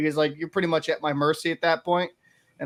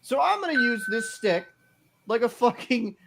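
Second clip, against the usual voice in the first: mock cocky taunt; 6 semitones higher than usual. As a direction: sneer it like the cocky guy hacking at you. Quoting the dirty hockey player.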